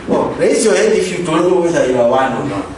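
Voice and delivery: ordinary delivery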